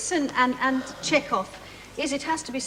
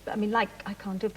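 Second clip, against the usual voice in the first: ordinary talk, nothing marked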